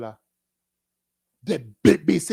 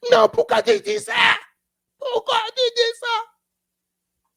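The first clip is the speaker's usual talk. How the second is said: much higher, louder protesting cry; hammed-up high protest, stretched out; indignant outcry; 4 dB louder, slower than usual. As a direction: whine back in loud protest. Acted quarrel, shouter protests.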